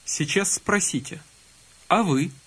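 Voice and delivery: normal talking voice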